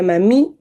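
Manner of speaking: "mami" con el acento en la I final. Shown as a demonstration. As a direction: carga la voz en la última sílaba de "mami". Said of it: "con acento en la I". ("mami" aguda, maMÍ)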